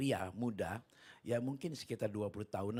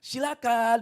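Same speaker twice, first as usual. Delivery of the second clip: high, booming comic answer; loud reply; 12 semitones higher, 15 dB louder, slower than usual. Retold or imitated. Gang member lets the preacher pass, loud comic shout. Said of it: "dia bilang gitu".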